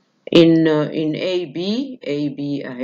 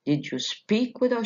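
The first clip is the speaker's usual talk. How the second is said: "speak" carries the stress, "with her" unstressed and high; high question tune from "speak" onward; 7 semitones higher than usual. Shown as a demonstration. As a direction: ask it with "speak" accented, voice staying up on "with her". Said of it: "The last content word اللي هو the verb".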